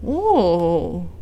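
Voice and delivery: plain reading voice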